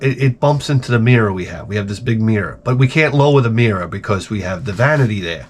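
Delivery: ordinary talk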